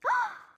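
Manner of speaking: loud, very high gasp; sharp, high intake of breath; shocked gasp. Real, not acted. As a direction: gasp hard in happy shock. Finds no duplicate card.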